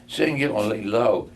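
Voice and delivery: ordinary delivery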